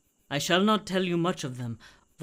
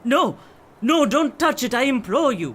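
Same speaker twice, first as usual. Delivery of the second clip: higher and louder, begging in panic; frantic pleading; 6 semitones higher, 6 dB louder than usual. Acted. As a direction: beg him desperately to stop. Swami begs him not to touch.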